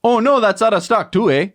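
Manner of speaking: mock Canadian accent. Co-host echoes the sold-out joke in a mock Canadian accent. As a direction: play a cheery Canadian who is let down. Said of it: "not all Canadians talk like this".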